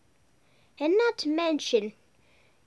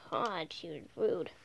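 whisper; 8 semitones lower, 10 dB quieter than usual. Real, not acted. Gossip after player leaves.

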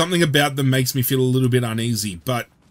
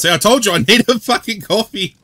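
higher and louder, mock-annoyed at himself; half-joking tired rant about needing coffee; exasperated outburst; 9 semitones higher, 8 dB louder than usual. Real, not acted. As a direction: blurt it out, fed up and tired. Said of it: "I yawned a little bit there".

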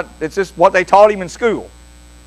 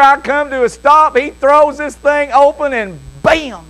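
usual speaking voice

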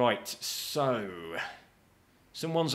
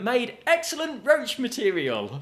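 higher, mock-innocent announcement of the joke; cheeky punchline; 11 semitones higher, 5 dB louder, slower than usual. DJ on the mic teasing the club crowd, cheeky. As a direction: slip in the punchline with a knowing lift. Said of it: "we told people".

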